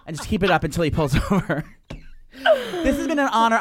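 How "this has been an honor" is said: laughing. Acted. Hammy gushing sign-off with laugh.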